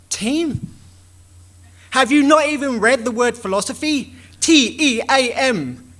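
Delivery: high, loud mock indignation, letters spelt slowly; scornful disbelief. Philosophers scolding an engineer for mentioning a team, scornfully incredulous. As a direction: act an affronted philosopher, incredulous.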